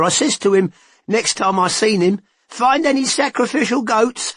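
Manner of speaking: a gossiping informant, keen to tell; Todd's voice. A narrator's reading.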